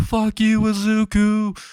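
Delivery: a falling man's high, furious cry; screaming. Acted. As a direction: hurl it as he flies out the window. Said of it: "and screaming".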